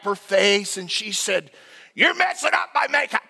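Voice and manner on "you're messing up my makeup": high, sharp mock shout; mock outraged yell. Laboring wife to coaching husband over a washcloth, mock outraged yell. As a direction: imitate an indignant wife, pitched way up. Wife snaps during labour.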